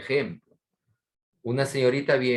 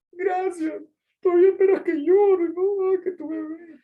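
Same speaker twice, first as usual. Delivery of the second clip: high, far, louder whiny taunt; mock sobbing taunt; 7 dB louder than usual. Daughter taunts the father who gave in, high whiny mockery. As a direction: whine it like a spoiled teen who won, very high.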